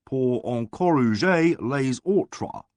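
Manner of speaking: put-on slow, heavily mangled English-accented French; diabolical French. A narrator's reading. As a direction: grind out bad French with menace. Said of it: "said the man in diabolical French".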